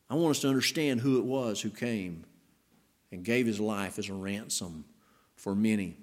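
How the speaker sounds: normal talking voice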